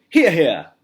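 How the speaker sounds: high, loud cheer; shouting. A narrator's reading. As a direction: cheer together for the move. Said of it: "they all shouted".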